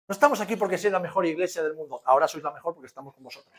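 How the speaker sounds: loud, emphatic pulpit delivery; forceful preaching. Real, not acted. Telling the church why they came, forceful preaching. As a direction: drive it out loudly to the congregation.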